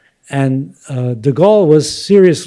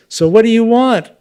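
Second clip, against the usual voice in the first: higher, exasperated question from the union boss; exasperated union leader; 8 semitones higher than usual. Retold or imitated. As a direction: throw up your hands at the crowd. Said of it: "the union leader says".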